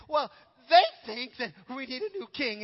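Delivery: loud blurt. Retold or imitated. Cornered advisor confesses to the king, loud blurt. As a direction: blurt the bad news out in one loud rush.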